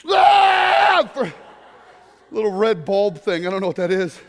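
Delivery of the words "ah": very high, loud held scream; newborn scream. Retold or imitated. Newborn seeing the world, long high scream. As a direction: wail like a newborn.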